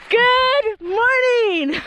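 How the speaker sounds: loud, high, slow sung-out greeting; joyful shout. Real, not acted. Hiker on top of Eagle Rock, greeting the morning with a loud, joyous shout. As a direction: belt the greeting to the whole valley.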